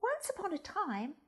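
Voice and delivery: plain reading voice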